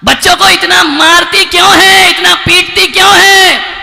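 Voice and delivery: shouted outraged question; loud complaint. Acted. Preacher shouting the people's complaint. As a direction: hurl the question at the crowd.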